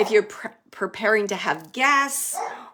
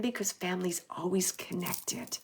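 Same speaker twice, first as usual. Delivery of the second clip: whisper; 2 semitones lower, 7 dB quieter than usual. Retold or imitated. Relaying spirit softly.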